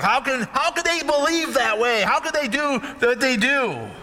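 high, scandalized mock voice; mock churchgoer. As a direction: send up the pious gossip, well up. Mocking self-righteous churchgoers.

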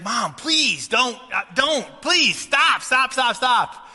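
a hint of a desperate son begging Mom to stop; pleading son. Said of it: "And so he's like".

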